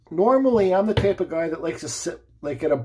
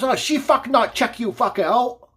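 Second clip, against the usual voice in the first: high, slowed broken-English yell; retold angry yell; 7 semitones higher than usual. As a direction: imitate the man's accented shouting. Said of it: "he looks at me and he yells".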